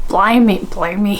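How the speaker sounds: mock West Country exclamation; Hagrid. Host failing at impersonations, mock West Country. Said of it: "I can't do impersonations".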